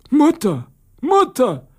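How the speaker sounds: high, strained call for mother; hoarse cry. A narrator's reading. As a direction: cry out hoarsely, pitched far up. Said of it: "rief er dann mit tiefstem Bass, rau und heiser". (heiser und hoch gerufen)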